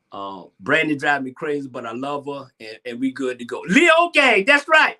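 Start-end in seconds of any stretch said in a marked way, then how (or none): 3.7-5.0 loud, high-pitched cheer; hype shout-out. Real, not acted. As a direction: shout out your crew with pride. Hype shout-out to fellow Leos.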